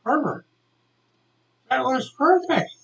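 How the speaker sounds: high-pitched astonished praise; lightly acted stunned teacher; amazed teacher. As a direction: praise a pupil in mild disbelief. Teacher retold praising pupil's German.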